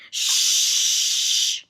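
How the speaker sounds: sharp unvoiced shush; cautioning shush. A narrator's reading. The man silencing Milo with a shush. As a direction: hush the boy urgently. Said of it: "he cautioned".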